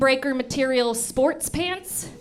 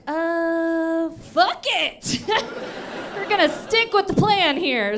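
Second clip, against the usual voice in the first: parents shrugging; 5 semitones higher, slower than usual. Retold or imitated. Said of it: "they were heard to remark".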